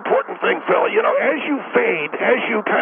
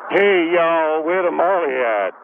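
imitating Phil; 5 semitones lower, much slower than usual. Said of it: "she heard you going".

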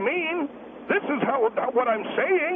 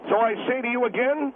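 normal talking voice

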